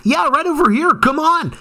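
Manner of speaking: high-pitched, excited retold invitation; eager helpful local. First passerby offering to lead a tourist to poutine, eager. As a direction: imitate a stranger eagerly leading the way. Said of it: "First person was like".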